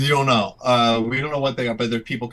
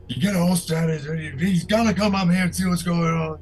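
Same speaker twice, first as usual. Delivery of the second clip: high, far-off guard voice calling up the stairs; 7 semitones higher than usual. Acted. Guard on the stairs calls for Holstead, raised voice. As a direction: call for Holstead. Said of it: "you can hear".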